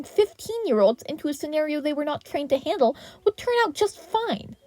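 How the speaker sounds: teacher countering the hero's excuse. A narrator's reading. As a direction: fire back point by point. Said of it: "Izuku countered".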